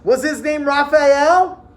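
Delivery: loud, high, drawn-out question; eager guessing. A narrator's reading. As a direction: shout the guess like an eager pupil. Pupils eagerly guess the boy.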